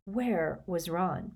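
plain reading voice

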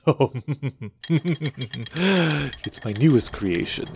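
slow, laughing mad doctor voice; mad scientist. Acted. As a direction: gloat over your creation slowly. Podcast skit, mad scientist reveals creation.

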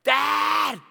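high-pitched cry for Dad; imitated kids' yell. Scared kids in the dark basement, yelling for dad. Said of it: "They would start yelling my name".

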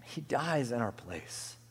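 soft, slow and solemn; subdued, weighty reverence; hushed repeat. Real, not acted. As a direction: let it fall quietly, word by word.

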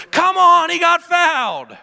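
loud high protest, dragged out on fouled; angry coach yelling. Retold or imitated. Opposing coach yells at the young ref over a missed foul, loud and high. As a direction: yell it like a sideline coach who is fed up. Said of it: "the coach over there goes".

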